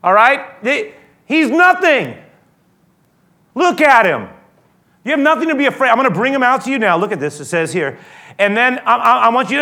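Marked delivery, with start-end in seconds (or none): 0.0-7.0 loud, raised voice calling out to a crowd; Pilate addressing crowd. Retold or imitated. Pilate calls to the people outside, loud and pleading. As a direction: announce it to the whole crowd, high and loud. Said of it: "he says".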